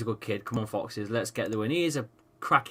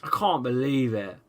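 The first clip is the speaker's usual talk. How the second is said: ordinary talk, nothing marked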